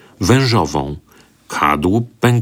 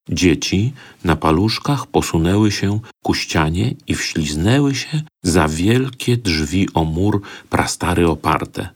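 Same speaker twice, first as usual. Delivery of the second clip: usual speaking voice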